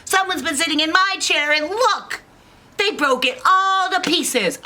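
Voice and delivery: very high, loud little bear; squealed complaint; upset Baby Bear. Acted. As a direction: wail it like a small cub. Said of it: "then Baby Bear says".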